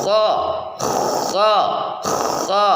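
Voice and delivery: kho diucapkan dengan rongga tenggorokan atas menyempit; kho serak dari tenggorokan atas; pelafalan kho. Shown as a demonstration. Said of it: "cara pengucapannya". (kho dari pangkal tenggorokan, sedikit menyempit)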